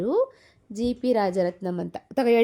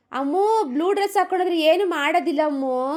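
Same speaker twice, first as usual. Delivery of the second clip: put-on high, coaxing mother to child; coaxing daughter; 8 semitones higher, 4 dB louder than usual. Mother coaxing her crying daughter, high and sweet. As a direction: soothe the crying girl, pitched up.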